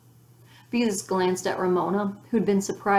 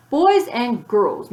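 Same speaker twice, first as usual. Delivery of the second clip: slow, much louder call to the room; raised voice; 4 semitones higher, 7 dB louder, much slower than usual. A narrator's reading. Teacher calling the noisy class to order, raised voice. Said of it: "raised her voice".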